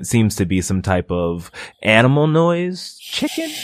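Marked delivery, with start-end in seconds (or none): 3.0-3.5 a startled high-pitched question; surprised squeak. Real, not acted. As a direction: leap way up on the word, soft. DM guesses the animal noise.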